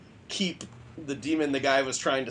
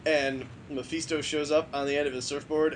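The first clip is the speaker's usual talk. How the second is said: ordinary talk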